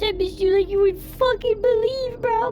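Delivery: high-pitched, overexcited gushing; gushing excitement. Real, not acted. Streamer telling viewers he missed them, gushing excitement.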